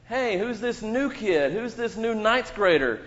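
lightly voiced teasing older kid; mock bully. Pastor playing older bully to child, mild mock taunt. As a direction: tease the new kid, half-playful. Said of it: "I am pretending that I'm a big eleventh grader".

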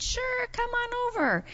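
high, singsong welcome; welcoming. Acted. Host answering a girl's request, welcoming in a high voice. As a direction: invite the child in with a high lilt. Teller acts welcoming reply.

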